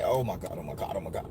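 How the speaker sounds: very low, soft repeated mutter; faint muttered dread. Retold or imitated. Slipping into trance, retelling dread.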